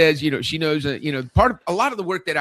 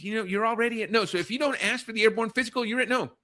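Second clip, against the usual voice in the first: higher, lightly voiced daughter; a hint of a bright young daughter giving advice; quoting daughter; 6 semitones higher than usual. Host quotes his daughter's advice to the guest, higher voiced. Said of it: "She says".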